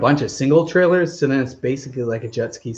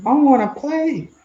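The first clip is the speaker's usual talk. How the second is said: much higher, slow puppy voice; playful puppy voice; 14 semitones higher than usual. Pretend. Owner voicing his puppy begging to play, high slow puppy voice. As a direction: beg to play like a tiny pup. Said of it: "And she's like".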